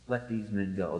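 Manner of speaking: low, soft, settled command; low quiet authority. Retold or imitated. Preacher voicing Jesus, low soft authority. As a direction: speak it low with calm power. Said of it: "with that authority".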